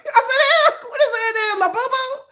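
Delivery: high, loud, laughing through the words; laughing disbelief. Retold or imitated. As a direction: crack up while asking it. Laughing mock of the cousin's friend.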